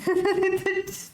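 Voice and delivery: high giggling laugh. Real, not acted. Player amused at the game, high laugh.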